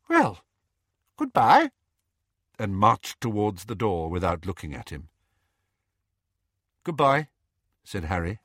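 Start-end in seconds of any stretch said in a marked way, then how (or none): 0.1-1.7 high, loud, abrupt parting; stiff aunt. A narrator's reading. Aunt Petunia leaving her nephew without looking, high and stiff. 6.8-7.3 slightly raised, simple goodbye; nephew's reply. A narrator's reading. Harry returning his aunt's farewell, simply and lightly voiced.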